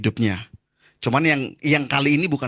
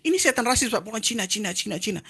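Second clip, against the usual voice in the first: insistent high voice; 9 semitones higher than usual. Retold or imitated. Man insists the demon is racist.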